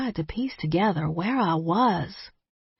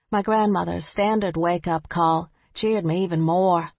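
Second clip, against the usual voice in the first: ordinary delivery, nothing marked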